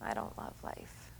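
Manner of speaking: put-on child's whisper. Child voiced in story.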